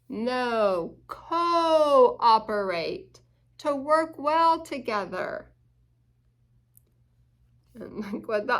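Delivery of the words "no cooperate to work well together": cooperate stretched very slowly, every syllable clear; drawn-out cooperate. Shown as a demonstration. Reader correcting the doctor, slow careful word. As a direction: spell out "cooperate" slowly for the child. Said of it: "what's that word?".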